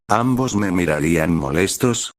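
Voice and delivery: usual speaking voice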